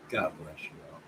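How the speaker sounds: a hushed second goodbye; soft repeat. Real, not acted.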